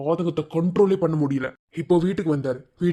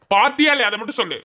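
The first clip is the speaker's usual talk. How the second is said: high, louder demand; angry demand; 5 semitones higher, 3 dB louder than usual. Acted. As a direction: insist sharply. Angry husband demands answer. (கோபமான கேள்வி)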